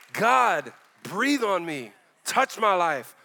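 loud, emphatic plea to God; impassioned, punched-out prayer; fervent prayer. Real, not acted. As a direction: cry out each phrase forcefully. Switches from singing to fervent prayer.

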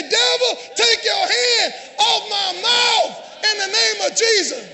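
forceful, drawn-out preacher's command; commanding deliverance cry. Acted. Preacher leading congregation to rebuke the devil, commanding cry. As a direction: cast it out at full force.

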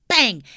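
loud shouted sound effect; shouted bang. Acted. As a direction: yell the bang.

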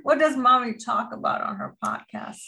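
high, sing-song mommy voice; bright and sweet, as if to a toddler; talking to a child. Acted. Mom introduces her daughter to the podcast, high sing-song.

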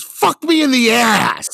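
high, loud, slow exasperated yell; frustrated outburst. Retold or imitated. Comedian quoting actor's on-set blunder, loud yell. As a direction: blurt it out in mock despair. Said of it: "and just goes".

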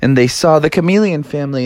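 plain reading voice

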